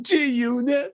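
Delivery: high, drawn-out mock shout; mock yell. Imitating a woman yelling out in bed, mock yell. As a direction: yell it out like a hype call. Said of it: "And she yells out".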